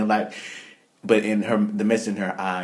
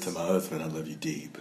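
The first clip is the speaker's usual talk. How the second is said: dropping voice deep; 4 semitones lower, 7 dB quieter than usual. Retold or imitated. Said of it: "make her voice sound so deep".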